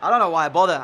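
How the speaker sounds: loud, fed-up groan of a line; exasperated outburst. Real, not acted. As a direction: sound like you've had enough of this game. Fuming after conceding a goal.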